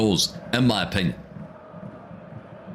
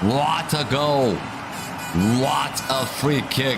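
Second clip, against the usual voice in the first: high, slow, savouring every word; awed goal praise; 4 semitones higher, slower than usual. Real, not acted. Streamer marvelling at the free kick just scored, awed praise. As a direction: draw out each word in amazement.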